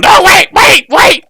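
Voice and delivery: very high, loud goblin cries; goblins shouting. Acted. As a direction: goblins yelling to stop the arrows. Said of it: "the others are going".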